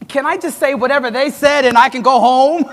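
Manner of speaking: half-laughing joke about skipping the speech. Retold or imitated. Keynote speaker jokes to the audience, laughing. Joking he could just leave.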